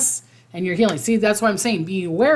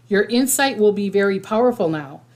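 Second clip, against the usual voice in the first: ordinary talk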